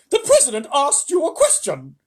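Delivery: high, loud, cold rebuke; icy. A narrator's reading. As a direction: freeze him with your tone. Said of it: "in an icy voice".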